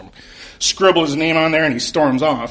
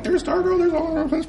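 much higher, eager fan gush; really excited; 14 semitones higher than usual. Retold or imitated. Fan listing the characters to himself, much higher and gushing. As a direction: gush over the cast. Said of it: "really excited".

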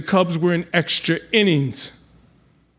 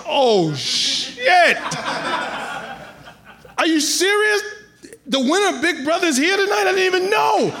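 much higher, rushing, laughing disbelief; shocked outburst. Retold or imitated. Comedian recalls learning the rival won Big Brother, high shocked laughter. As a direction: squeal it in stunned disbelief.

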